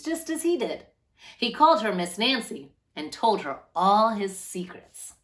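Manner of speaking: steady, clear storybook reading; gentle read-aloud to children. A narrator's reading. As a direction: read it like a picture book for a class. Reads picture book to children.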